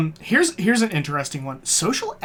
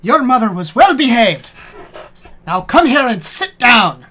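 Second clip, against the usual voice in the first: high, loud mock-angry shout; exasperated, hammed-up telling-off; scolding dog trainer; 6 semitones higher, 9 dB louder than usual. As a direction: yell commands at a disobedient dog. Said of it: "Especially while training dogs".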